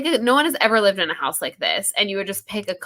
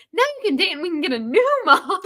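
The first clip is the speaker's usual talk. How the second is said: mock the little sister's line, laughing through it, pitched higher; 9 semitones higher than usual. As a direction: giggle through a lightly voiced kid's line. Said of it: "have the little sister say".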